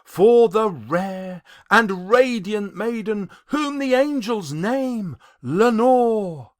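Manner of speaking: ordinary delivery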